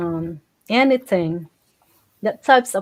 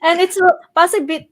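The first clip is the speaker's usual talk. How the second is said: laughing; 8 semitones higher, 5 dB louder, faster than usual. Real, not acted. Laughing while resuming reading.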